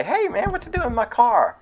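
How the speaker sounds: high, alarmed "hey, what are you doing"; indignant car owner. Pretend. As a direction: catch a thief in your car. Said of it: "you're probably gonna say".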